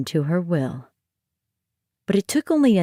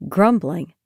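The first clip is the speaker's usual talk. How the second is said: ordinary delivery, nothing marked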